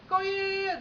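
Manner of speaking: high, sustained run of yeahs; eager caller. Retold or imitated. Client confirming the booking, high-pitched eager agreement.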